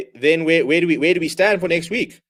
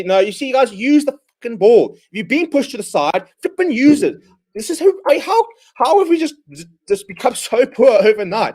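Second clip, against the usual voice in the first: furious shout; 6 semitones higher than usual. Real, not acted. Fan screaming at players to use the ball, furious. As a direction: bellow it like you can't believe the mistake.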